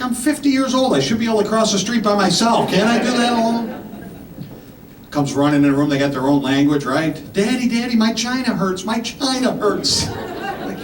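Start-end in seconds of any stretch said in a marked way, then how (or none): 0.0-3.7 mock indignant. Comic retort to wife. 7.3-10.1 put-on little girl voice.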